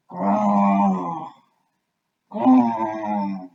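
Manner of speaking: loud groaning growl; pained growl. A narrator's reading. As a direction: groan through a sore jaw. Crocodile groaning in pain.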